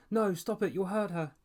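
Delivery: high, loud panicked cry. A narrator's reading. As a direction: shriek in alarm to stop him. Girl panics as ball thrown.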